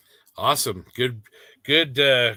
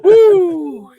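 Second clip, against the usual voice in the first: loud whoop; 13 dB louder than usual. Real, not acted.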